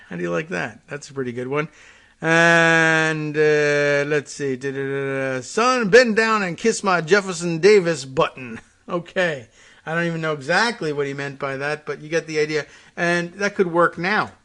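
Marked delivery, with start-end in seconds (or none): 5.4-8.6 blustery Southern put-down; Senator Claghorn. Acted. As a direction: order it as a hammed-up Southern senator. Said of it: "senator's other anti-Northisms included".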